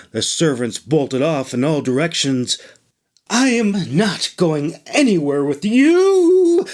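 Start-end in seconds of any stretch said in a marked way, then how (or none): 3.3-6.7 high, slow defiant shriek; pitched-up indignant refusal. Acted. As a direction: refuse her, shrill and drawn out. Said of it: "Traja shrieked".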